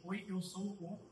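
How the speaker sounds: ordinary talk, nothing marked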